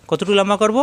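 ordinary talk, nothing marked

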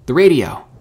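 loud, high, insistent burst; urgent shout. A narrator's reading. Herschel repeats his order to Mika, shouting urgently. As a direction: bark the order impatiently.

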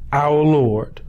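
ordinary talk

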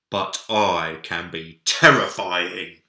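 slow, loud put-on menace; mock terrifying bear. A narrator's reading. Big Bear teases Minik with slow, loud mock menace. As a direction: play at being scary, slowly. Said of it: "said Big Bear".

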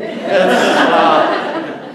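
ordinary talk, nothing marked

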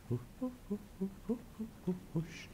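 high, soft ghostly hooting; spooky ghost. Acted.

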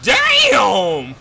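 very high, loud, stretched exclamation; shocked shout. Real, not acted. Gamer hit by a strong enemy, shocked shout. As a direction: yell it out in astonishment.

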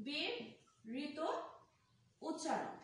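term broken up and drawn out; slow, separated syllables with a pause; slow dictation. Shown as a demonstration. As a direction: spell out the term slowly for note-takers. Teacher repeats term slowly for students.

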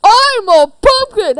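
slow, loud monster boom; booming pumpkin voice. Acted. Pumpkin creature announces itself.

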